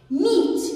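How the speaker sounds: "Meat" as one high, unbroken beat; one-syllable demo. Shown as a demonstration. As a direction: punch out "Meat" in a single sound. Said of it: "one syllable". ("Meat" بمقطع واحد ونبرة مرتفعة)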